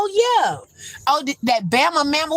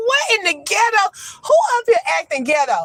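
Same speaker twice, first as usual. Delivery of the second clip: amused disbelief; 10 semitones higher than usual. Retold or imitated. Reacting to school email, amused.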